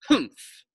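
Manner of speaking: sharp high huffy humph; girl's offended humph; indignant humph. Acted. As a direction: snub him with a huff. Leslie rejecting the apology.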